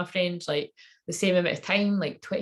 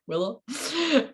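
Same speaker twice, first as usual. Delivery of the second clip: doubtful self-question; 9 semitones higher than usual. Retold or imitated. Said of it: "and I was like".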